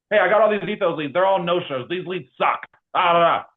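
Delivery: high, loud mock whining rant; mock griping. Agent moaning to manager about no-show leads, mock griping. Acted complaint, contrasted with asking.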